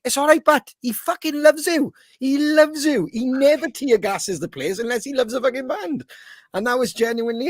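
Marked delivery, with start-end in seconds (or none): 0.1-6.0 mock reassurance. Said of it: "everyone's like".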